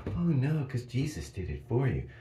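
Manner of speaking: high, loud mock believer voice.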